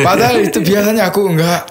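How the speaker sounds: high, fast words through a laugh; nervous laughing. Real, not acted. Korean convert before reciting for host, laughing nervously. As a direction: laugh it off, embarrassed.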